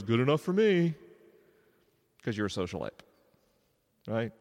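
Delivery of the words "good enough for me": easygoing brain. Acted. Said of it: "your brain goes".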